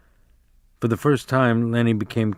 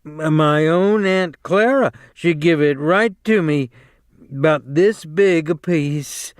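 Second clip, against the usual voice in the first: lightly voiced simple-minded big man, higher; a childlike Lennie voice, a bit higher; 5 semitones higher, 3 dB louder than usual.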